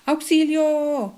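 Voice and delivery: loud, drawn-out cry for help, high; exclaimed long. Shown as a demonstration. Teacher showing an exclamation, drawn-out auxilio. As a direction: stretch out auxilio, slow and loud. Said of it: "Veamos unos ejemplos".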